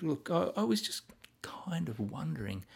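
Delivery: whispered, hesitant aside; mock timid whisper. Timid officer whispering an awkward request. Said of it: "That's not battlefield talk".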